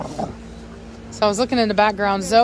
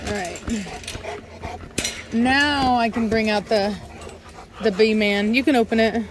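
ordinary talk, nothing marked

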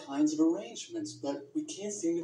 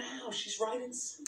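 whispering; 5 semitones higher, 6 dB quieter than usual. Real, not acted. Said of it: "Why are you whispering?".

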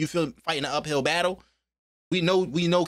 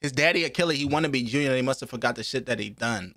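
ordinary talk

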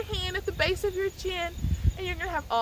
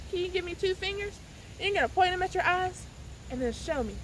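ordinary talk, nothing marked

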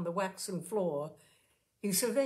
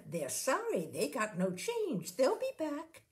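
mocking the girls; 6 semitones higher than usual. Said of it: "and then saying".

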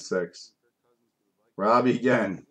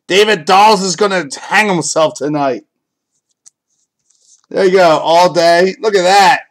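loud, high-pitched hype over a big pull; excited celebration; 6 semitones higher, 5 dB louder than usual. Real, not acted. Card breaker celebrates a Vikings hit for viewers, excited and loud.

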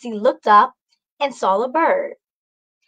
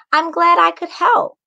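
higher, louder proud reply; 7 semitones higher, 3 dB louder than usual. A narrator's reading. Macy to the old lady, proud. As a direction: say it with pride. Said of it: "Macy proudly said".

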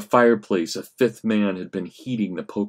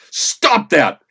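loud, higher roar; 9 semitones higher, 7 dB louder than usual. Acted. Frank roaring at the mob, full loud shout. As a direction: bellow it to stop them. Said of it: "Frank roared with all of his power".

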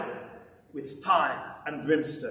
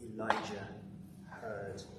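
much softer and lower, reverent hush; hushed awe; 7 semitones lower, 9 dB quieter than usual. Acted. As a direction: let it fall to a near-silent reverence. Describing the still small voice.

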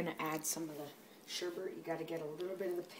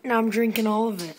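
loud; 5 semitones higher, 15 dB louder than usual. Real, not acted. Joking boast over the blender.

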